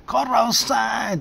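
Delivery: furious retold yell; angry shout. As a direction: bang on the door and bellow at the neighbor. Friend bangs on door shouting.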